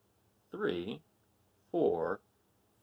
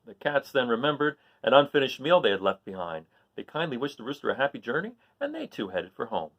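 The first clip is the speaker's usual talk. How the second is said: lively read-aloud storytelling; story narration; 3 semitones higher, 4 dB louder than usual. A narrator's reading. Teacher reading a story to the class, lively narration. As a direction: read it like a picture book to kids. Reads a picture book aloud.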